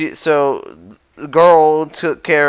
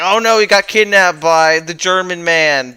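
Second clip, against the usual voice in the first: higher, louder retold "oh no"; mock alarm; 4 semitones higher, 5 dB louder than usual. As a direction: playfully act out alarm at bad news. Retold alarmed reaction to kidnapping.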